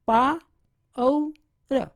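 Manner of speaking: पावर split into पा, औ, र; teacher spelling out पावर aloud; syllable by syllable. Shown as a demonstration. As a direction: break पावर into its sounds. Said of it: "पावर".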